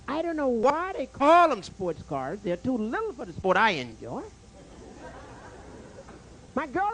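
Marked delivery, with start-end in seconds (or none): 0.1-2.4 slow deliberate setup. Real, not acted. Slow set-up of the joke.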